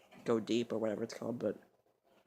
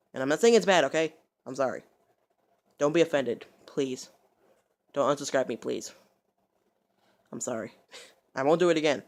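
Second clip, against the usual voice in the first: loud, insistent appeal; pleading apology; 3 semitones higher, 10 dB louder than usual. Real, not acted. Apologizing to viewers so they don't unsubscribe, pleading. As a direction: plead with bursts of volume.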